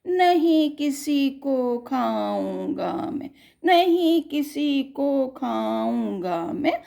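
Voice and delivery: slow sing-song rhyme reading; poem recital. Acted. The tiger promising friendship, sing-song recital.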